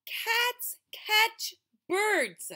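put-on slow, high, drawn-out child's voice; boy voice to kitten. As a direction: spell it out slowly to the kitten. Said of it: "the boy told the kitten".

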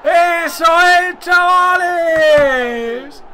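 high screams, words stretched out long; real goal celebration. Real, not acted. Player celebrates scoring against Barça, triumphant screaming. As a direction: yell in triumph, drawing out each word.

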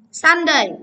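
Sunday with SUN punched high and loud; stress on first syllable. Shown as a demonstration. Teacher giving the next word, stress on SUN. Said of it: "Trọng âm một".

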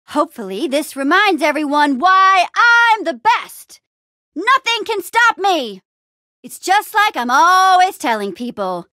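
high, loud, stretched boasting; triumphant crowd address. Acted. Hero showing treasure to everyone, triumphant proclamation.

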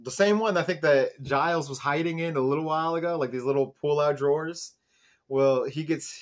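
ordinary talk, nothing marked

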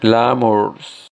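Glamours with a rolled R. Shown as a demonstration. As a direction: curl the tongue on the r of Glamours. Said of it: "enrosquemos la lengua". (Glamours con la R enroscada)

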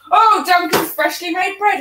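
ordinary talk

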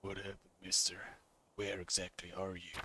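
low, quiet gunslinger voice; understated, deep-pitched hero; laconic gunslinger. Acted. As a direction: answer very low and soft. Voices game hero answering the priest.

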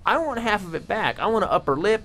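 ordinary talk, nothing marked

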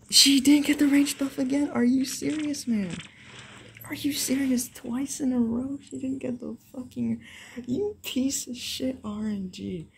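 high, loud disbelieving rant; frustrated rant. Real, not acted. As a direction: rant at the game, high and outraged. Raging at bad luck.

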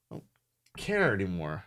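normal talking voice

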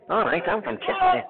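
high-pitched, badly faked Scouse; attempted Scouse accent. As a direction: have another hopeless go at Scouse. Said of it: "I was trying to do Scouse".

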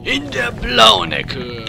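slow booming ring announcer; lucha libre announcer. Pretend. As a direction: stretch out the enemy's name. Introduces enemy like ring announcer.

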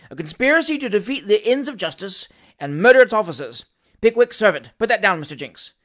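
loud, officious pronouncement from the bench; pompous magistrate. Acted. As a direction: lay down the charge self-importantly. Voices pompous magistrate Nupkins.

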